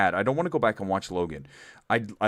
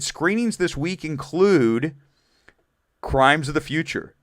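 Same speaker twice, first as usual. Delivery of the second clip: higher, louder, slowly spelled out; mock scolding; 7 semitones higher, 5 dB louder, slower than usual. Host teasing a viewer who missed it, mock scolding. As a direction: repeat it pointedly, spacing out the words.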